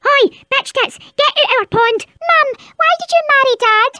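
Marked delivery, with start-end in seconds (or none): none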